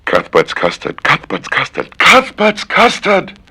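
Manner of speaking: a tongue twister ending in a slow falsetto; climbing to a squeal. Shown as a demonstration. Repetition climbing to a shriek.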